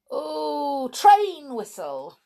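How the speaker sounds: usual speaking voice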